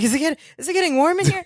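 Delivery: high, fast, flustered; frazzled. Retold or imitated. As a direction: sputter it like you're overheating. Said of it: "so frazzled and nervous".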